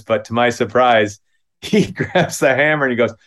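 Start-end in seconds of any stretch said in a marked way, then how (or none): none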